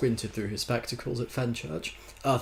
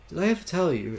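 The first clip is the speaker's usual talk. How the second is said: higher-pitched interjection; interrupting; 7 semitones higher, 5 dB louder, faster than usual. A narrator's reading. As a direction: cut in on a raised pitch. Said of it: "interrupted Fenchurch".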